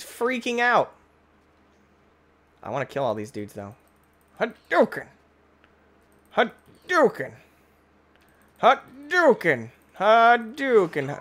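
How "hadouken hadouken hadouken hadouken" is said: high, drawn-out attack call; video-game battle cry. Pretend. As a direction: call out a mock special move.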